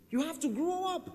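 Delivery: high, loud exhortation; preaching at full force. Real, not acted. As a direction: push the congregation to grow up.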